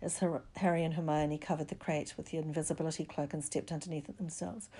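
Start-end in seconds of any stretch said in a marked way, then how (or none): none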